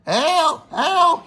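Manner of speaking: high, mock cry of help; mock scream for help. Donald, unable to stop, calling for help in a mock scream. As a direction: call out like a runaway engine. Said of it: "he screamed".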